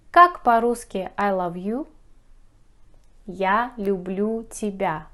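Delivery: ordinary delivery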